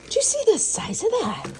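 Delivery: very high, incredulous squeal; astonished. Real, not acted. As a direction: squeak it out in amazement at the size. Amazed by the size.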